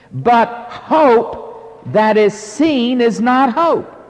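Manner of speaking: slow, ringing pulpit reading with long pauses; emphatic scripture reading. Acted. Preacher reading the verse on hope to the congregation, emphatic scripture reading. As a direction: proclaim the verse phrase by phrase, key words drawn out. Reads the Bible verse aloud.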